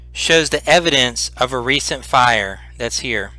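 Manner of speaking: slow, measured read-aloud description; game master's scene setting. A narrator's reading. Game master describing the ruined chamber to players, slow and measured. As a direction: paint the room for the players slowly.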